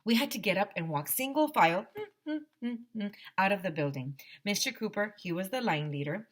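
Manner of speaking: usual speaking voice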